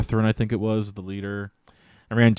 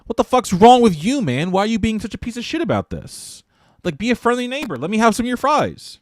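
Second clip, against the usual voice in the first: high-pitched indignant whine of protest; mock outrage; 11 semitones higher than usual. Fry thief to diner who won't share, mock outrage. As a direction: scold as if you were the wronged one, very high.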